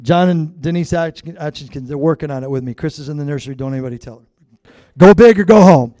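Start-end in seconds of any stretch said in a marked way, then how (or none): 5.0-5.9 loud declaration. Real, not acted. Pastor tells congregation his anniversary plan, loud boast. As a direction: punch it out loud and proud.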